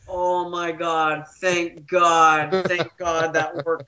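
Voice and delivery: slow, low relieved sigh. Retold or imitated. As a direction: exhale the words in relief. Relieved inner thought.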